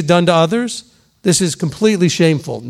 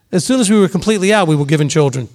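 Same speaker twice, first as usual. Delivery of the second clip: usual speaking voice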